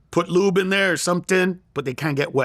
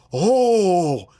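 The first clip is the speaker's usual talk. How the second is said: mock crowd shock, rising higher; freaked out; 4 semitones higher, 3 dB louder, slower than usual. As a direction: imitate a startled audience reacting in disbelief. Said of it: "And they'd freak out".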